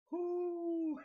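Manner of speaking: loud, high, long whoop; excited whoop. Real, not acted. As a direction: let out a joyful fan whoop. Whooping over Sunday's game.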